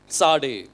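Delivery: சாடே as "tsaa-de", pitch dropping low; ts pronunciation. Shown as a demonstration. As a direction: begin சாடே with "ts" as in "its". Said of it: "இட்ஸ் sound வரும்". (சாடே-வை "ட்ஸ்" ஒலியில் தொடங்கி)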